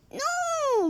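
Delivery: high drawn-out moan. Acted. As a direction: whine a long no. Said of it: "moaned Dennis".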